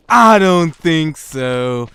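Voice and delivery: loud, very drawn-out denial; mock scepticism. Answering his own question about sincerity, mock sceptical. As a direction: belt it out slowly, dragging each word.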